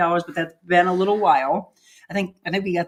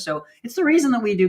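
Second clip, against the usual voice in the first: ordinary talk, nothing marked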